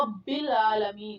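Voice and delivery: رب with a full heavy r, slow; رب العالمین said slowly with a full r. Shown as a demonstration. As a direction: fill out the r in رب. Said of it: "بھر کے پڑھنا ہے".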